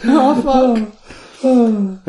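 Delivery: high strained cry; high exclamation. Real, not acted. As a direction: blurt it high and pained. Dismay sound.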